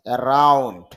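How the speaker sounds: Around with a strong, long RA; stress on second syllable. Shown as a demonstration. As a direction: hit the রা in around. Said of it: "রার মধ্যে stress mark আছে". (Around, রা-তে জোর)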